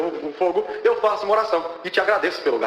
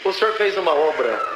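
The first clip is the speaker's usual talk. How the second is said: usual speaking voice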